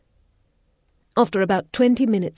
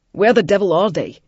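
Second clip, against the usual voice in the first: loud alarmed demand; 3 semitones higher, 8 dB louder than usual. A narrator's reading. Daniel demanding of the searchers, loud and alarmed. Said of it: "he demanded of the searchers".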